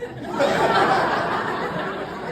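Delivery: high breathy laugh; real high laugh. Real, not acted. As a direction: laugh out loud at the memory. Laughing at own story.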